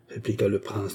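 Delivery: ordinary delivery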